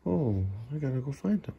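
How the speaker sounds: ordinary delivery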